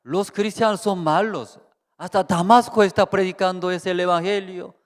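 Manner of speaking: loud, high-pitched denunciation; indignant accusation. Acted. Preacher voicing Saulo's thoughts about Christians, indignant.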